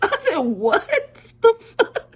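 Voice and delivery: high shriek cracking into laughter; hammed-up incredulous outburst; loud disbelief. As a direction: shout it, nearly losing it laughing. Retells shock at the lift news.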